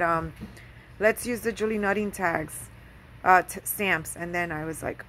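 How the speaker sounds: usual speaking voice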